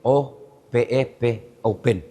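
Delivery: "O P E P" spelled letter by letter, then "open" read as a word; spelling out slowly. Retold or imitated. Villager at the mall spelling the word on a door. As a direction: sound out each letter like a villager reading a sign. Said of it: "dia tiba-tiba dia eja".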